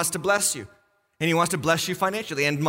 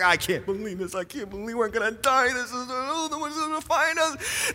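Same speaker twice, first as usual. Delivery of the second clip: hushed panicky voice climbing higher; mock freaking out; 4 semitones higher, 4 dB quieter than usual. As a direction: act out a comic panic, softly, pitch rising. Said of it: "The atheist is freaking out".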